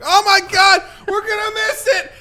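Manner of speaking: very high, loud squealing panic; panicked unicorns. Retold or imitated. Retelling a cartoon, squealing panicked unicorn voice. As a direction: shriek it like a frantic cartoon unicorn. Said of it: "They're like".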